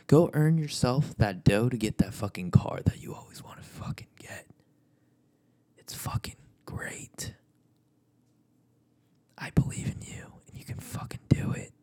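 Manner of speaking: intimate whispered pep talk. Acted. Vlogger whispering encouragement to viewers, hushed whisper. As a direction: lean into the mic and whisper it.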